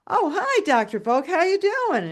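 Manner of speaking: high-pitched phone hello to the doctor; acted cheery greeting. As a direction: greet the caller in a lifted, sociable voice.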